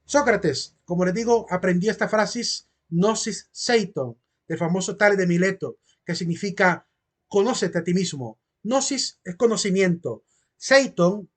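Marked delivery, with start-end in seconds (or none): none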